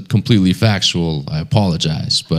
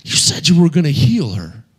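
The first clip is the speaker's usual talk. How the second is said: high, pained complaint to God; a betrayed preacher arguing with God; frustrated with God; 8 semitones higher than usual. Retold or imitated. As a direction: let the hurt climb up high. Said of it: "He's all frustrated with God".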